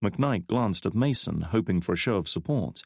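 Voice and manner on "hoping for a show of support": ordinary delivery, nothing marked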